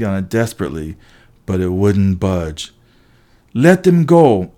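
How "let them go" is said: loud high shout; defiant yell. A narrator's reading. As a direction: yell a demand across the gym. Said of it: "I yelled at the giants".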